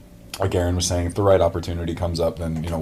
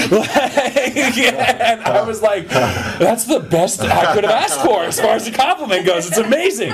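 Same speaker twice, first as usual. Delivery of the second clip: laughing; 5 dB louder, slower than usual. Real, not acted. Laughing through the retelling.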